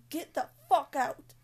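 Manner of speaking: loud but restrained yell; half-hearted shout of the scream; retold scream. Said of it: "I was like".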